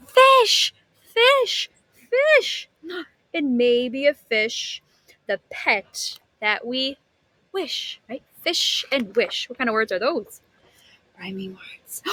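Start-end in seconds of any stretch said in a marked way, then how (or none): 0.1-2.7 high, loud, eager repeated fish; a child begging for a fish; excited little sister. Acted. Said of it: "She said". 2.8-9.5 lively storybook reading voice; animated read-aloud for a class; reading to children. A narrator's reading. 11.2-12.0 secret whispered answer; hushed teacher whisper. Real, not acted.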